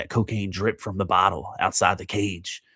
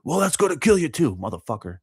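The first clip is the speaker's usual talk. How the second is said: mocking the guy; 7 semitones higher, 3 dB louder than usual. Mocking the guy's retort.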